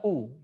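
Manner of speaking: "u" with lips pushed forward; rounded u. Shown as a demonstration. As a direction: push the lips far out on "u". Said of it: "Bibirnya harus dimajukan".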